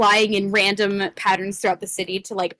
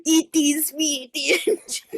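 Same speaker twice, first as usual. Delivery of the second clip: slow, higher mock Scottish accent; 4 semitones higher than usual. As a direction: slowly ham up a Scottish brogue. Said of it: "definitely a Scottish accent".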